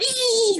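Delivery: high imitated pony squeal; mock horse whinny. Horse noise imitated in story.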